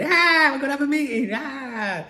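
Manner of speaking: high, frantic mock screaming; mock panic scream. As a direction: ham up panicked club staff. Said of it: "everyone running around screaming".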